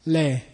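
medium-length 'Le'; middle length. Shown as a demonstration. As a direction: hold the vowel of 'Le' a little. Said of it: "three different lengths of it".

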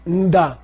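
NDA opened with a nasal hum; prenasal. Shown as a demonstration. Teacher repeats word for arrow, prenasal Nda. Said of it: "It's a ma before the da".

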